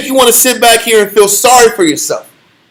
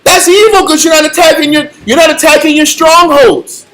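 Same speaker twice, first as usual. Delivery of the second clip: preaching emphasis; 6 semitones higher than usual. Real, not acted.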